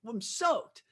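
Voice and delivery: ordinary talk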